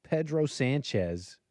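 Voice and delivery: Pedro Sanchez said slowly and deliberately; playful drawn-out name. Pretend. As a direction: savor Pedro Sanchez, word by word. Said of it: "you could say Pedro Sanchez".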